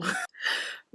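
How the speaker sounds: high unvoiced giggle; high breathy laugh. Real, not acted. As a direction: burst out in a silent giggle. Laughing at story.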